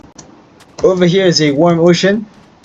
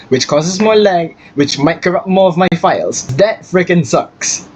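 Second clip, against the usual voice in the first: ordinary talk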